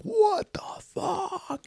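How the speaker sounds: very high, slow, wailing cry; crying out in shock. Retold or imitated. Stu seeing Earth from the pod, wailing in panic. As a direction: let it crack upward into a panicked wail. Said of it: "He's like".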